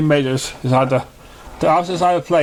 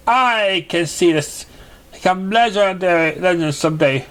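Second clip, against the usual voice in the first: higher, theatrical voice of the contest judge; grand judge character; 5 semitones higher than usual. Acted. Judge announcing contest rules to the three ponies, higher grand voice. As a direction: announce it like a pompous magical host.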